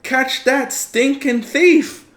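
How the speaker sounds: high, slow furious yell; shouting. Acted. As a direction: bellow after the thief. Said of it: "Bill says".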